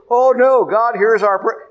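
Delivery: high-pitched mock objection; alarmed protest. Preacher voicing a believer who insists God hears prayer, alarmed protest. Voices an objector to God's refusal.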